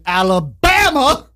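drawn-out, high-pitched movie-quote Alabama; Forrest Gump. Retold or imitated. Host announcing the state, Forrest Gump-style drawl. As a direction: stretch it out and pitch it up. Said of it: "why I say it like that".

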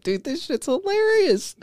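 high, slow, stretched amusement; amused disbelief. Retold or imitated. Moviegoer cracking up at the kills, high, slow amusement. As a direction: savour how funny it is.

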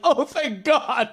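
high, loud, drawn-out relief, laughing; exaggerated relief. Retelling learning the actor's character was British, hugely relieved. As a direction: wail it out slowly in mock relief.

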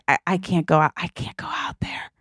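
choked whisper, acting out crying; re-enacted crying, voice giving out; imitated tearful panic. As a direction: whisper it as if too tearful to speak. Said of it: "And I start crying".

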